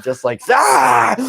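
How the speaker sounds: high, loud, drawn-out Agatha shriek; a hammed-up yell; mock shriek. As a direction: scream it like an over-the-top witch.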